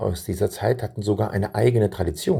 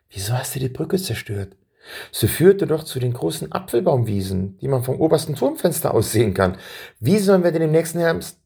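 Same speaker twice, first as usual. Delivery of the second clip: higher, rushing indignant questions; upset questions; 6 semitones higher than usual. A narrator's reading. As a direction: pile up the questions in dismay. Boy upset about bridge.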